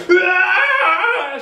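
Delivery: very high, loud raging cry; furious roar of Thor. Acted. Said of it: "shouts Thor".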